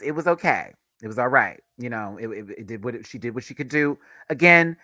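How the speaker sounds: usual speaking voice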